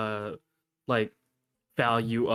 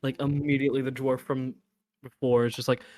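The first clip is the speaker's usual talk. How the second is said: ordinary delivery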